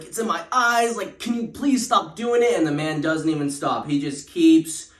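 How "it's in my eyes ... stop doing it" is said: high-pitched hammed-up begging; mock pleading. Brother begs the man to stop, hammed-up and high. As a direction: whine at him to stop. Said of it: "But he's like".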